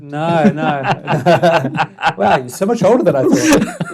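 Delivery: loud, laughing joke. Real, not acted. Joking back at the listener's teasing, laughing.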